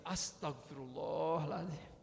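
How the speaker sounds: slow, softened calming prayer; istighfar dilembutkan. Acted. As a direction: draw it out gently to cool the anger. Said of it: "sudah dilembutkan". (istighfar pelan dan lembut)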